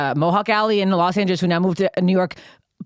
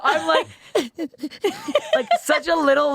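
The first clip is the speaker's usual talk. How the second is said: high laughing squeals between words; laughing at herself; 11 semitones higher than usual. Real, not acted. Still laughing at her crying.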